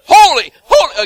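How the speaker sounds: high, forceful shout, falling at the end; demonstrated shout. Shown as a demonstration. Preacher showing congregation the seraphim's cry, loud shout. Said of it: "Again and again".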